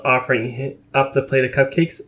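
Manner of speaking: plain reading voice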